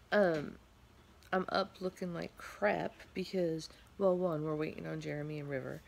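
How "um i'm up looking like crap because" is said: usual speaking voice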